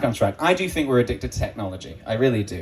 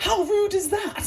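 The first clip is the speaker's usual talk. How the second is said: high indignant mock whine; shrill entitled woman, laughing; mock the complaining mum; 4 dB quieter than usual. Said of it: "they were like".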